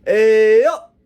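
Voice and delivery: loud, high drawn-out holler; junkie's street call. Retold or imitated. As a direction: imitate a street call to passing cars, cut off sharply at the end. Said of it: "the junkie's call".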